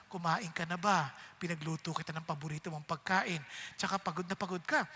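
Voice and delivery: soft, low, caring voice; tender wife. Acted. Wife gently welcoming her husband home, tender and soft. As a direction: welcome him home sweetly.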